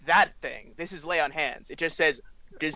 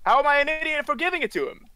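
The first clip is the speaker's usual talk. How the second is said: higher, louder as it ends; indignant protest; 9 semitones higher, 4 dB louder than usual. Acted. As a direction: protest your innocence, pitch rising. Indignant character protests being called idiot.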